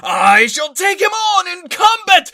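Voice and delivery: booming overacted enraged yell; furious shouting. Shown as a demonstration. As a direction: rage like a Saiyan. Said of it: "And if he was angry".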